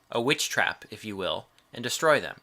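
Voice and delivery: ordinary talk, nothing marked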